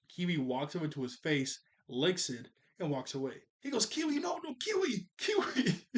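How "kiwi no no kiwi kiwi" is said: much higher, frantic mock calling; mock yelling. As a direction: imitate someone desperately calling back a pet. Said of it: "he's yelling for Kiwi".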